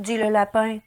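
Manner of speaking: usual speaking voice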